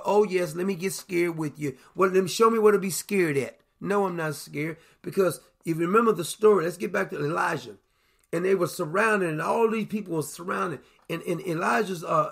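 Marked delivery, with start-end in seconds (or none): none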